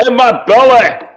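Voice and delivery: hammed-up Scottish brute; Fat Bastard voice. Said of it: "Fat Bastard".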